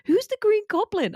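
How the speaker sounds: very high, clueless question; baffled colleague. Retold or imitated. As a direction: ask it totally puzzled. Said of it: "Some people were like".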